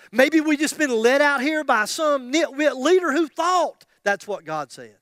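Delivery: high, loud, indignant protest; angry complaint to God. Acted. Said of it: "maybe you're just angry".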